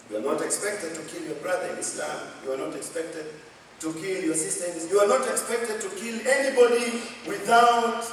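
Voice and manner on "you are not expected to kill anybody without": high, forceful admonition; emphatic preaching. Real, not acted. Preacher warns congregation against killing, emphatic preaching. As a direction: drive the prohibition home, raised and loud. Preacher drives the prohibition home.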